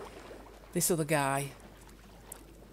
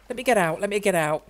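normal talking voice

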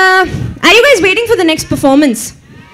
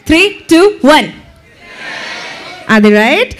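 ordinary talk

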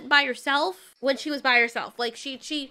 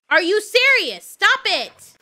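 loud, higher exasperated yell; furious, louder and higher; angry outburst; 6 semitones higher, 8 dB louder, slower than usual. Real, not acted. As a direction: snap at the game to stop. Angry at attacking bird.

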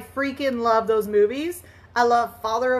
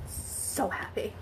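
whispered; 3 semitones lower, 12 dB quieter, slower than usual. Real, not acted. Delighted to get waffles.

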